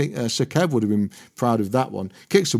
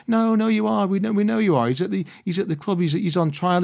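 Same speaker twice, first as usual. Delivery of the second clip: higher, needling reporter voice; pushy reporter; 9 semitones higher than usual. Retold or imitated. Reporter pressing Harry in the car park, mildly mocking insistence. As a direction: press him, you know he's signing.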